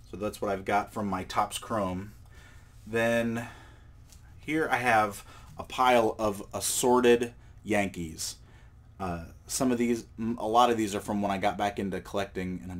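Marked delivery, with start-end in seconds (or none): none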